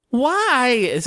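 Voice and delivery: "Why" pitched high and stretched out; stressed all-caps reading. Shown as a demonstration. Host reading a listener's all-caps question, heavy stress on "Why". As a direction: hit "Why" high and hard. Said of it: "I'll try to stress the all caps words".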